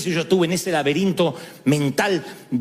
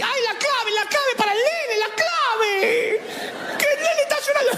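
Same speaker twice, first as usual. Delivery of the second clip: very high, frantic doting mom voice; fussy mother. Retold or imitated. Overprotective mother frantically asking for wifi password, very high and shrill. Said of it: "hay madres así".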